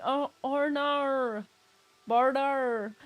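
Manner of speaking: put-on high, slow, overblown Australian accent; exaggerated Australian accent. Mocking the actors' overdone Australian accent. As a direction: stretch the Australian accent to no end. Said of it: "try to do the Australian accents".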